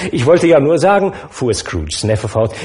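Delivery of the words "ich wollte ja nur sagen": put-on higher, louder, pleased young man; delighted host beaming at the table; bright jovial nephew; cheerful nephew. Nephew resumes.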